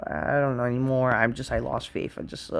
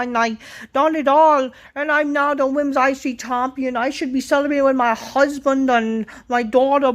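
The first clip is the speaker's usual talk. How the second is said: high, loud mocking whine of a self-important wrestler; mocking Becky Lynch; 12 semitones higher, 5 dB louder than usual. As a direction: ham up her boastful promo in a high voice. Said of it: "Becky Lynch comes out how".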